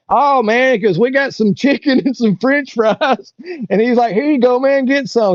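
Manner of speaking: high excited wino voice; generous wino. Retold or imitated. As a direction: voice the drunk stranger high and excited.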